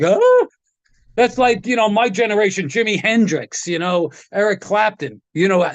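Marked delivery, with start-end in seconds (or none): 0.0-0.5 stunned gasp. Retold or imitated. Vinny stunned by Django.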